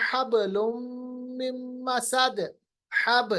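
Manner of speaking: हबलुम recited with a bouncing ब; qalqalah demo. Shown as a demonstration. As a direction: echo the ब of हब, hold the nasal. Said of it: "उसी में आता है". (हबलुम में बा का क़लक़ला)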